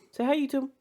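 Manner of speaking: high little coo to a cat; small high baby-talk voice; pet-talk voice. Pretend. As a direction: pitch it way up, as if coaxing a pet. Talking to or for his pet cat.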